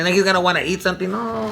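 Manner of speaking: ordinary delivery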